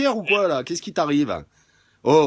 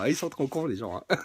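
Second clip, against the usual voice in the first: ordinary talk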